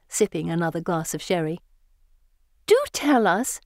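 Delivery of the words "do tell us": put-on high-pitched girlish pleading; imploring. A narrator's reading. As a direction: beg eagerly to hear more. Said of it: "Elizabeth implores".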